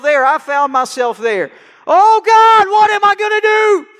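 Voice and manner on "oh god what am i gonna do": loud, high cry of despair to God; a man at the end of his rope, crying out; desperate cry. Retold or imitated. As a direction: throw your voice up, pleading with God. Acting his own desperate cry.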